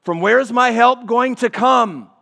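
high, loud anguished plea; crying out the question. Acted. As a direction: call it out like a worn-out pilgrim. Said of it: "prompts the question".

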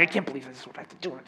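muttered complaint, rushed and soft; begrudging grumble. Retold or imitated. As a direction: grumble it quickly like a resentful giver. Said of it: "begrudgingly or in anger or frustration".

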